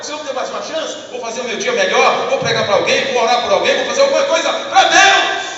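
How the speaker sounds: building to a loud preacher's shout; fervent vow shouted to the rooftops; zealous shout. Acted. Preacher acting a zealous believer.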